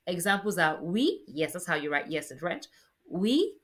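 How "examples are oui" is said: normal talking voice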